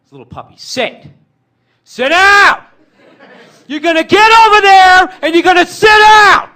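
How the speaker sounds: builds from calm to a screaming rage; calm command turning into a huge high yell; yelling dad. Retold or imitated. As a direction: lose it at the puppy. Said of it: "he's sitting there going".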